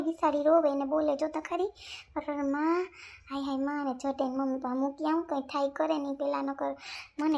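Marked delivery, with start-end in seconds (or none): none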